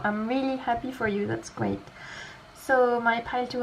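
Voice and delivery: normal talking voice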